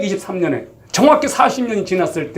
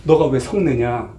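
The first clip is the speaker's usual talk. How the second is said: slow, softer voice of God calming Jonah; gentle soothing; 7 semitones lower, 3 dB quieter, slower than usual. Retold or imitated. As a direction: soothe him like a patient father. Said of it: "하나님의 반응이 더 놀라워요". (요나를 달래는 하나님, 부드럽고 느리게)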